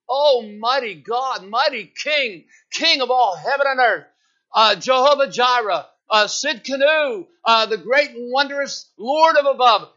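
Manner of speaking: loud, high, grand mock prayer; hamming up a showy long-winded prayer; pompous prayer warrior. Preacher mocking the old saints' showy prayers, loud and grand. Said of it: "They'd start their prayer".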